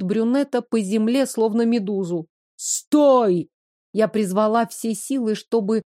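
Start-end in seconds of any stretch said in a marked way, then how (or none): 2.6-3.5 shouting. Acted. Heroine shouts at charging demon.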